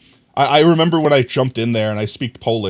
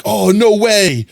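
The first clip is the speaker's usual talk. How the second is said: slow, drawn-out fake awe, a bit higher; mock amazement; 7 semitones higher, 4 dB louder, much slower than usual. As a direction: stretch it out like pretend wonder. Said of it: "Or be like".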